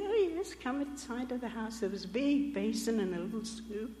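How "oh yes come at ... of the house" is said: cheerful hosts. Retold or imitated. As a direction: chirp it like the welcoming hosts. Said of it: "they said".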